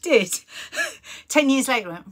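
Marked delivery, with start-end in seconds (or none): 0.4-1.2 laugh. Real, not acted. Laughing at ten years.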